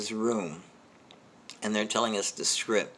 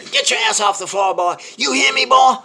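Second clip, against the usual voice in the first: high, furious drill sergeant shouting; yelling sergeant; 12 semitones higher, 10 dB louder than usual. Retold or imitated. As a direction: scream threats and orders at a recruit. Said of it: "getting louder and louder and louder".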